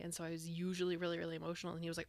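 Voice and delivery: ordinary talk, nothing marked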